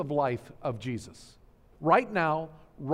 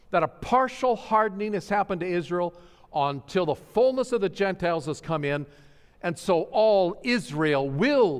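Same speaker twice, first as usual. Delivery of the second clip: louder, higher, proclaiming at full force; triumphant preaching; 5 semitones higher, 6 dB louder than usual. Acted. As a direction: lift the congregation with ringing good news. Preacher builds to triumphant climax.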